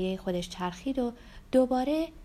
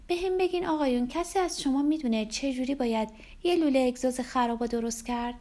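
higher woman's voice addressing the table; coaxing Rebecca; 5 semitones higher than usual. Acted. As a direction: flatter the men into helping. Rebecca charms the men for help.

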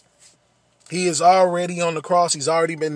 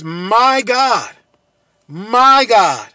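anguished cry; 7 dB louder, slower than usual. Acted. Jesus on the cross calling out to God, a loud anguished cry. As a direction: call out to God in abandonment. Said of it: "cries out with a loud voice".

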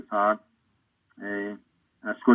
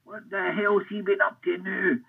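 higher, slow grumbling boss voice from inside; exasperated boss; 9 semitones higher, 4 dB quieter than usual. Retold or imitated. Manager Eddie hearing Joe's at the door, slow exasperated grumble. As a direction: play the fed-up manager calling from the next room. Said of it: "all I heard was Eddie saying".

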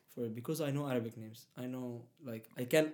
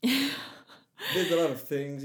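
slow, laughing through the words; chuckling it out slowly; laughing at how hard it all is; 3 semitones higher, 7 dB louder, much slower than usual. Real, not acted.

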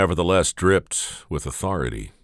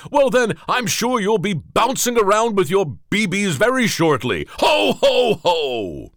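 louder, higher jolly Santa with booming laughs; 14 semitones higher, 5 dB louder than usual. Acted. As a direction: send him off with a merry laugh. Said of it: "Santa Claus".

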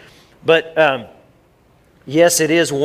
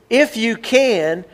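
"If you can" drawn out, higher and slow; stress on IF; 8 semitones higher, much slower than usual. Shown as a demonstration. Preacher stressing Jesus' words to the church, slow and high. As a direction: lean hard on "If you can" and stretch it. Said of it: "He says".

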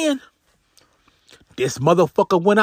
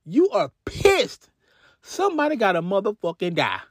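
ordinary delivery

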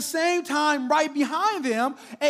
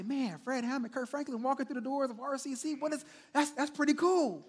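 flustered fan; 9 dB quieter, faster than usual. Retold or imitated. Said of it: "I might get a little flustered".

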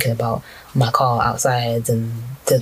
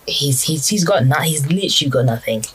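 usual speaking voice

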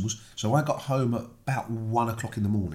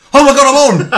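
retold panicked yell, high and loud; startled shout; 17 dB louder than usual. Recalling going live straight after the video, startled shout. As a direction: burst out loud and high in mock alarm.

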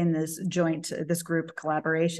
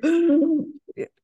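startled gasp; 10 semitones higher, 6 dB louder than usual. Retold or imitated. Reacting to teacher's remark.

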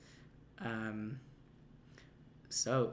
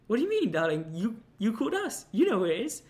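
higher, louder, sing-song "darling" voice; madam on the phone, higher and louder; cooing madam; 9 semitones higher, 7 dB louder than usual. Retold or imitated. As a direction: tease the caller like a brothel madam. Phone woman calls her darling.

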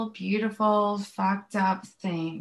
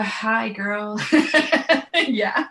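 laughing; 2 semitones higher, 7 dB louder, much slower than usual. Real, not acted. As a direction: burst out laughing as you greet her. Delighted laugh at Saturn question.

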